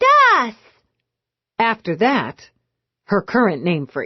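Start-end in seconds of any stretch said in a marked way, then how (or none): 0.1-0.5 put-on high, squealing baby voice; baby girl squealing for her aunt; toddler squeal. A narrator's reading. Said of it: "Bella squealed".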